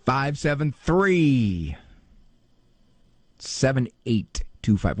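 drawn-out, half-speed number readout; exaggeratedly slow. Shown as a demonstration. Host spoon-feeds the phone number to slow note-takers, exaggeratedly slow. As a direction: stretch each digit out with long pauses. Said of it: "Say it slower".

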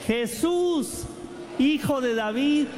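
fervent chanted plea; leading a plea. Shown as a demonstration. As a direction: lead the crowd in crying for mercy. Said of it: "A ver, digamos todos".

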